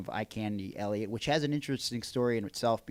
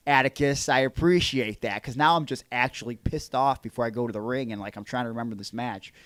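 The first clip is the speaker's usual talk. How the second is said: loud, annoyed, mock-grateful; sarcastic thanks, pissed off; 3 semitones higher, 8 dB louder than usual. Retold angry reply to Atticus.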